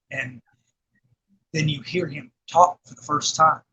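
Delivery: ordinary talk, nothing marked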